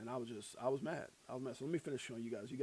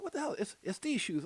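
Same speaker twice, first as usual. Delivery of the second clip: high, baffled mock protest; retold bafflement; 7 semitones higher, 3 dB quieter than usual. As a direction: squeak out your disbelief. Retold baffled reaction to wife.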